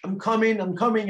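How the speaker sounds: high called reply; calling out. Acted. Young bear calling to Dad upstairs, high and eager. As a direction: call up the stairs to Dad. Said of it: "I say".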